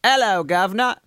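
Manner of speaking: exaggerated Cockney greeting; London accent, dropping the H in "Hello". Shown as a demonstration. Host repeats his go-to line, London accent. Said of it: "get into my London accent".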